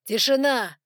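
loud, booming call for silence; thunderous command. A narrator's reading. Lucas silences the noisy hall, thundering loudly. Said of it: "раскатом грома прогремел голос Лукаса".

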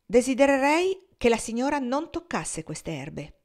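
a cook raising her voice at the lady of the house; rather loud. Acted. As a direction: speak up sharply to protect her herbs. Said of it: "con un tono di voce piuttosto alto".

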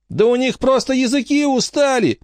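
put-on very high-pitched girl's protest; pleading to stay. A narrator's reading. Frankie trying to stop Angelica leaving, high pleading. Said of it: "попыталась остановить её Фрэнки".

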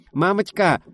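high loud call of mum; child shouting for mum across the flat; frantic cry. A narrator's reading. Daughter calling excitedly to her mother. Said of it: "донёсся заполошный крик".

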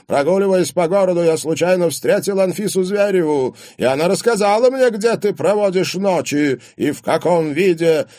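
loud, high-pitched accusing rant; angry tirade. A narrator's reading. Father tells what he heard of daughter's nights, raging. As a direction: hurl the accusation at her.

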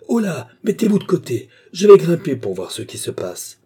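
loud, high-starting command; urgent call. A narrator's reading. Stangate about to climb and check, calling out urgently. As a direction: call out to clear the way.

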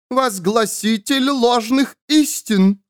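high, slow, ringing accusation; denouncing nun. A narrator's reading. Nun condemns the false prophet, denouncing. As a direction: proclaim it like a zealous accuser.

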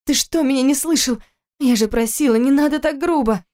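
high, hurt reproach; upset reproach. A narrator's reading. Wife reproaches husband for roughness, upset. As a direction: scold him through the pain.

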